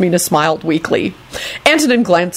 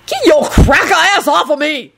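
high-pitched outraged holler; indignant holler; 10 semitones higher than usual. A narrator's reading. Lucretia pinned under Kenny, hollering indignantly. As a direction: holler to get them off you. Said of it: "Lucretia hollered".

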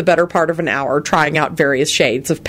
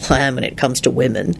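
gloomy; 5 semitones lower, 3 dB quieter than usual. A narrator's reading. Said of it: "he replied gloomily".

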